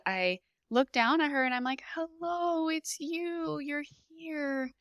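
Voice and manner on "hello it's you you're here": softly and higher, fading gentler to the end; tender greeting. Retold or imitated. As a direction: greet the baby like a first meeting, hushed and lifted. Mother greeting her newborn.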